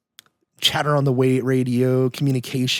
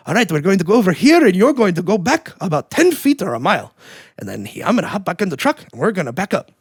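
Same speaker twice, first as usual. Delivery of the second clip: hammed-up Italian accent, higher and louder at first; weird Italian voice; 6 semitones higher, 3 dB louder than usual. Chris in a good mood directing the truck turnaround in a mock Italian accent. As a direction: imitate a buddy's goofy Italian accent giving directions. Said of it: "he gets into this weird Italian voice".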